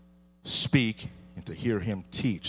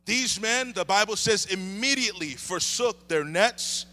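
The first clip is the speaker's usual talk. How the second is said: higher, lifted preaching voice; 9 semitones higher than usual. Acted. Pastor preaching to the church, lifted and higher. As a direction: preach it out to the congregation.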